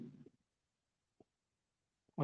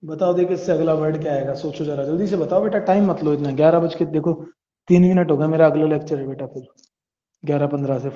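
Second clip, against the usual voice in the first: urging; 7 dB louder than usual. Real, not acted. Teacher hurries students.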